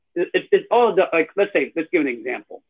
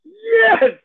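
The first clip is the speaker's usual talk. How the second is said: much higher, louder cheer; excited yes; 5 dB louder than usual. Real, not acted. Host cheering guest's French shout-out, excited yell. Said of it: "That sounded so fucking dope".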